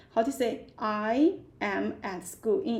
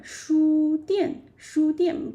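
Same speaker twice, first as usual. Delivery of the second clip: "书店" drawn out slowly; slow model word; 5 semitones higher, 5 dB louder, slower than usual. Shown as a demonstration. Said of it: "break down".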